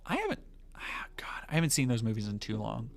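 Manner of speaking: soft unvoiced aside; whispering. Real, not acted. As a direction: whisper it to yourself.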